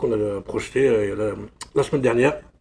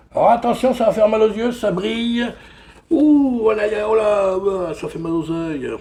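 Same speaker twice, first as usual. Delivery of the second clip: hammed-up warning, higher and louder; mock dazzled; 7 semitones higher, 5 dB louder than usual. Vlogger to viewers showing a shiny cassette, hammed-up dazzle. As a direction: pretend the shine hurts your eyes.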